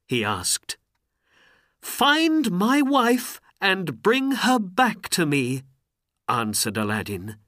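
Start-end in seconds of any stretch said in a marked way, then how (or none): 1.8-5.6 high, loud urgent plea; raised, pleading voice; desperate request. A narrator's reading. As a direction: implore the genie to help. Aladdin begs the genie.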